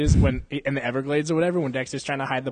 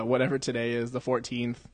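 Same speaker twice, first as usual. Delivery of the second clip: ordinary talk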